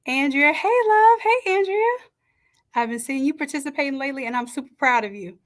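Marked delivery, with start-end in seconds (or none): 0.5-2.0 excited greeting. Real, not acted. Greeting a regular viewer warmly.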